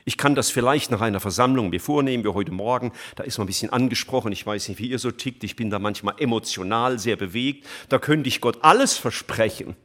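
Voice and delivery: ordinary talk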